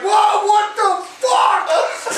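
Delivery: high, drawn-out shocked yelp; slow, high-pitched disbelief; startled outcry. Retold or imitated. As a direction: re-enact being blindsided, voice jumping up. His startled reaction to being punched.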